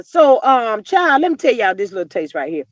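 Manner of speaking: usual speaking voice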